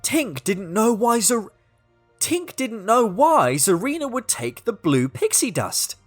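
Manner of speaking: ordinary delivery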